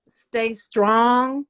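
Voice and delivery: a sudden loud push on the words; loud, firm encouragement to the women listening; rallying cry. Real, not acted. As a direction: call out to them to stay strong. Urging women to stay strong.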